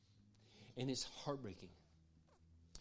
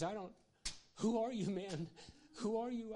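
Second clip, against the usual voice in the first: higher, overwhelmed and teary; choked-up crying man; 10 semitones higher than usual. Retold or imitated. Pastor retelling a crying worker's words, high and choked. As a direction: voice the man breaking down in disbelief. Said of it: "He started crying".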